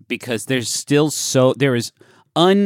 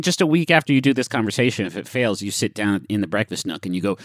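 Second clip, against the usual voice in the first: ordinary delivery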